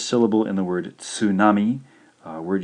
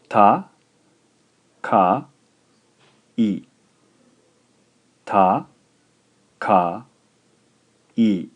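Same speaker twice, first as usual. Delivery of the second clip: "Ta, ka, i" spaced out slowly, each syllable louder and separate; 7 dB louder, much slower than usual. Shown as a demonstration. Teacher dictates the word slowly to students: spaced syllables. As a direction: spell it out syllable by syllable. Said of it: "I'll speak a word for you, slowly in Japanese".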